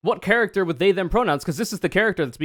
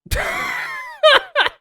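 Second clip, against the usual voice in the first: high burst of laughter at a botched word; a sudden shrieking laugh; loud shrieking laugh; 4 dB louder than usual. Real, not acted. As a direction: cackle out loud. Laughing at the mispronunciation.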